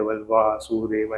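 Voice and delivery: Vasudev slowly split as वा-सु-दे-व; teacher counting out each syllable of Vasudev. Shown as a demonstration. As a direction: say Vasudev one drawn-out syllable at a time. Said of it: "ऐसे एक एक syllables होते हैं".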